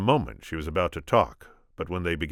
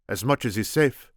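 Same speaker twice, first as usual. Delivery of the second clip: put-on Spanish accent; 7 semitones higher, 6 dB louder than usual. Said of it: "my attempt at Inigo".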